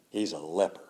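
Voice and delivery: sudden low, soft reveal; quiet grave drop. Acted. Preacher revealing the general's leprosy quietly. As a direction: drop the voice and let it land.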